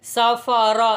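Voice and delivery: normal talking voice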